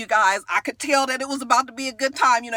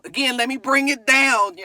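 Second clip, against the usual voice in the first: normal talking voice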